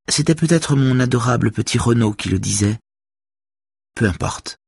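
airy show-off, a touch theatrical; vain socialite. A narrator's reading. Vain woman tells Saga who admired her, lightly theatrical.

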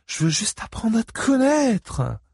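put-on high, drawn-out drunken pleading; slow, high-pitched bumbling drunk; stammering drunk. A narrator's reading. Angelo pleading with Ike to get to know him, stammering. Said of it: "bafouilla Angelo".

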